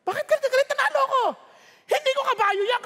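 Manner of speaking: high, loud proud outburst; excited jockey. Retold or imitated. Jockey to angry owner, high loud excitement. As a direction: crow about winning, clueless. Jockey boasting after race.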